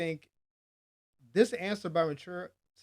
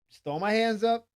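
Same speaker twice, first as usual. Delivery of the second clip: exasperated; 6 semitones higher, 6 dB louder than usual. Real, not acted.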